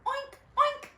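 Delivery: put-on high, squeaky oinking for kids; pig oink. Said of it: "grunted the pig".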